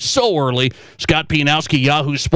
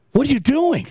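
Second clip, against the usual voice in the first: lightly voiced puzzled daughter, higher; a hint of a young girl asking, pitched up; mock-innocent kid asking dad; daughter's question; 9 semitones higher than usual.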